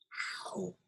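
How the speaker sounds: soft whispered question. Acted. Rabbit asks squirrel how, whispering. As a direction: breathe the word out. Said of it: "whispered the rabbit".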